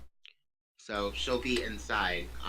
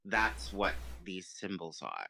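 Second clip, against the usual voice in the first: ordinary talk, nothing marked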